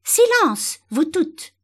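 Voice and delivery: high, loud command for silence; explosive order from an agitated flower; furious shout. A narrator's reading. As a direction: shout down a noisy crowd. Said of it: "cria le lys tigré".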